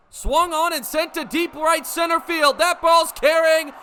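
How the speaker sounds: high, loud excited call; home run call. Acted. Announcer calling a home run for the fans. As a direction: lift the voice as the ball carries.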